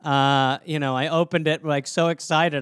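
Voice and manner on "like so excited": ordinary talk, nothing marked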